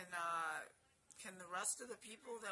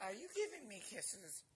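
ordinary delivery

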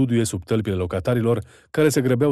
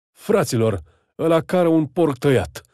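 higher, louder alarm yelled to the others; bellow; 5 semitones higher, 4 dB louder than usual. A narrator's reading. Hunter alerting his fellows to the pig thief, loud higher yell. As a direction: yell it out to rouse the men. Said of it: "zbieră".

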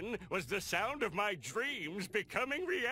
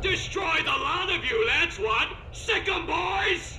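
a loud, high command to attack; villain's battle cry; 8 semitones higher, 5 dB louder than usual. Acted. Plankton unleashing his robots on the crew, loud villain command. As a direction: roar the order at your robot army.

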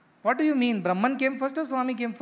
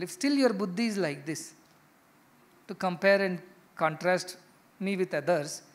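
usual speaking voice

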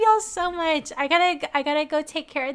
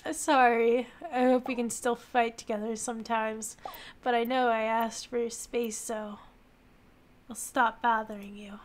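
soft, low apology; quietly regretful message; subdued apologetic character; 4 semitones lower, 4 dB quieter than usual. Acted. Absent lover texts an apology, low and subdued.

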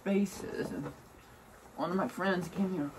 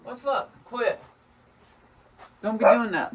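scolding; 6 semitones higher, 8 dB louder than usual. Real, not acted. Scolding pet.

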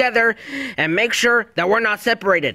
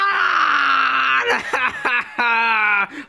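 hysterical crying laugh; 6 semitones higher than usual. Acted. Luigi hysterical, left all alone.

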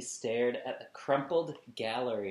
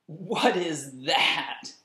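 slow, higher, louder jeering question; mocking brother; 5 semitones higher, 8 dB louder, much slower than usual. Brother laughing at a drawing, slow mocking jeer. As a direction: sneer it out like a mocking big brother. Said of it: "he asked".

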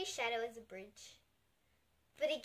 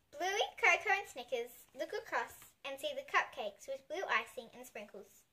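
usual speaking voice

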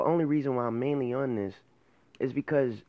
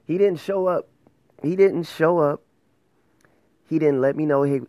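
loud, high, fed-up complaint; exasperated outburst; 6 semitones higher, 8 dB louder than usual. Real, not acted. Venting to viewers about a client who never showed, loud and fed up. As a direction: blurt it out, fed up with him.

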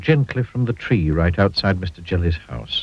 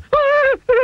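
terrified squeal; 5 dB louder than usual. A narrator's reading. Mister Jelly sees giant.

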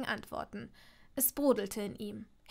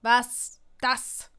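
rough, louder question; 7 dB louder than usual. A narrator's reading. Draco questions her about his secret, rough-voiced. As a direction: ask it with a rasp in the throat. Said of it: "fragte er mit rauer Stimme".

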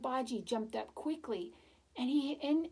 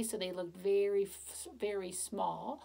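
ordinary delivery

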